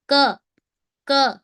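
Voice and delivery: k said as 'kuh' with a full vowel; Indian-style phonics sound. Shown as a demonstration. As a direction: voice a clear uh after k. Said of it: "teaching this sound as k".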